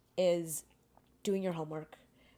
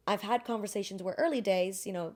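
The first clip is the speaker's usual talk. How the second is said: ordinary delivery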